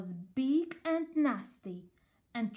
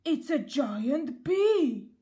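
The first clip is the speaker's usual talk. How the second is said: loud, slow, panicked bear shout; terrified shout; 4 semitones higher, 7 dB louder, slower than usual. A narrator's reading. The bear facing the giant bee, loud slow terrified shout. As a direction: yell it while fleeing.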